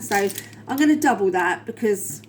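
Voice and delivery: normal talking voice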